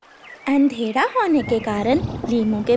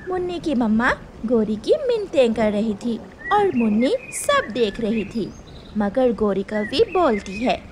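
ordinary delivery, nothing marked

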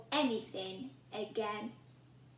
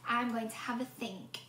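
ordinary delivery, nothing marked

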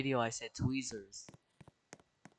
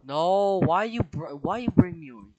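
indignant yell; 7 semitones higher, 8 dB louder than usual. Real, not acted. Dragged across room again.

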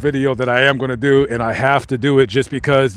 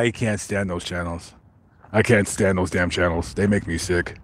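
low, much softer grumbling ghost; mock ghost voice; 8 semitones lower, 7 dB quieter than usual. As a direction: play a grumpy ghost through the static, hushed and low. Mock ghost answering on spirit box.

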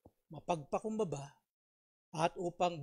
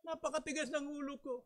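put-on crying child; 10 semitones higher, 6 dB louder than usual.

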